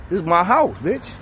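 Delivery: high, defiant claim; indignant emphasis. Retold or imitated. Claiming his house.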